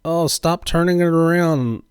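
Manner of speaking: normal talking voice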